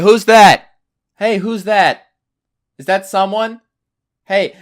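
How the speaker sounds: loud, high calling dad. Retold or imitated. Dad barging into the room, loud calling. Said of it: "my dad just comes in and he's like".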